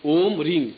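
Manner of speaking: ओम with a hummed म and रिंग with a sounded ग; clear mantra syllables. Shown as a demonstration. Guru repeating the sounds for disciples, careful pronunciation. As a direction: say ओम रिंग slowly and precisely. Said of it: "यहाँ ग की ध्वनि आएगी".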